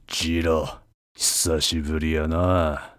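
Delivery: yakuza menacing drawl. Acted. Togo greets Jiro from behind.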